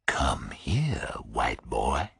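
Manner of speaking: whispered, low threat; quiet menace. Acted. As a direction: breathe the order, barely voiced.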